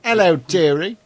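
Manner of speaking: put-on high old British lady voice; singsong British dearie; British accent. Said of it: "You gotta do British talk".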